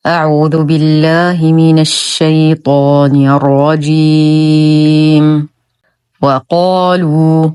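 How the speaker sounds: slow, measured tajweed chant; Quran recitation. Shown as a demonstration. Teacher opening a lesson with verse, slow Quran recitation. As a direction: draw out each long vowel, reciting very slowly.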